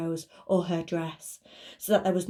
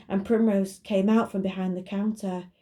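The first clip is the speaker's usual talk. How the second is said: usual speaking voice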